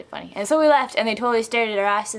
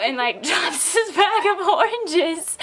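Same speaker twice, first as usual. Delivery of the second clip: real laughter breaking through the words; laughing; 6 semitones higher, slower than usual. Real, not acted. Telling friends about dropped oranges, laughing. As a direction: tell it through giggles, high and drawn out.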